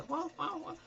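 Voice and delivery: high, soft imitated bark; dog barking. Preacher imitating a hungry dog, high barks. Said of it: "te grita".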